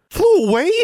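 high, loud startled question; exclaiming. A narrator's reading. Girl shocked her raven flew off, exclaiming. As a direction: blurt it out in disbelief. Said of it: "she exclaimed".

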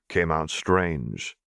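plain reading voice

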